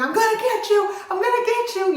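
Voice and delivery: high, loud mock battle cry; hammed-up menacing yell at a hawk; retold scream.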